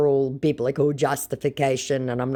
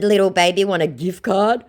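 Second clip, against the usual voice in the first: mock baby talk; 5 semitones higher, 5 dB louder than usual. Mocking the scammer.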